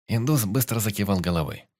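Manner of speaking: ordinary delivery, nothing marked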